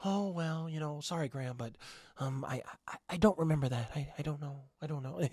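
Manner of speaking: mock mellow, hushed fake voice; [name]. Host imitating a YouTuber's fake apology, mock mellow. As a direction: imitate his meek, halting non-apology, very softly. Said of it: "[name]'s putting on this weird fake voice".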